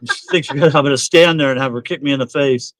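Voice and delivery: normal talking voice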